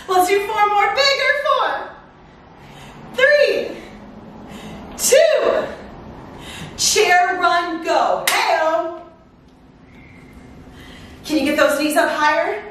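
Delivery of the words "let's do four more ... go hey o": upbeat high countdown, pumping up; aerobics instructor. Acted. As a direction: hype the class through the count.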